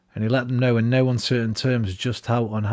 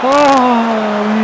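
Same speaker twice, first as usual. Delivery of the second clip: surprised cry; 11 semitones higher, 10 dB louder than usual. Real, not acted. Nearly scoring a goal.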